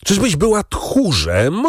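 agitated high, drawn-out question, rising at the end; rising agitation. A narrator's reading. Husband pressing his wife on her cowardice, increasingly agitated. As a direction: press the challenge slowly, pitch climbing high. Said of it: "ciągnął Villefort z rosnącym wzburzeniem".

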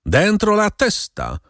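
wysoki, powolny, złowróżbny rozkaz; rozkaż przeciągle i wysoko, z lekką groźbą; złowróżbny głos. A narrator's reading. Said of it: "odpowiedział mu ten sam złowróżbny głos". (złowieszcze, przeciągnięte polecenie)

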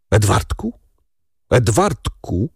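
halting, strangled call, second one dragged; choked out. A narrator's reading. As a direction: force the name through a tight throat. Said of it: "wykrztusił".

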